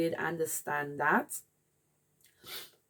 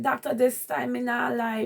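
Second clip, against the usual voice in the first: insisting; 6 semitones higher, 6 dB louder than usual. Retold or imitated. Insisting to doctor.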